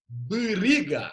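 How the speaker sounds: "Biriga" stretched slowly, each sound drawn out; slowed down. Shown as a demonstration. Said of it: "Se a gente falar devagar".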